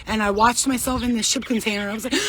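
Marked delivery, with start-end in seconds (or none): none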